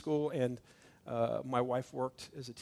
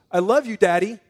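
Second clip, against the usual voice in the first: a little boy's put-on sweet voice, louder; sweet child voice; 5 semitones higher, 14 dB louder than usual. Toddler son answers his father with innocent sweetness. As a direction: coo it innocently to dodge trouble.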